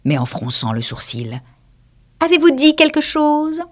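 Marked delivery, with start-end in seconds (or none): 2.2-3.6 high mouse, asking pointedly; suspicious mouse. Acted. The mouse questions the lory, high and prim. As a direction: test who interrupted.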